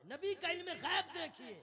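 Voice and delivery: high, loud "नबी का इल्म गैब देखिए"; rousing exclamation. Real, not acted. Preacher pointing crowd to the Prophet's knowledge, exclaiming.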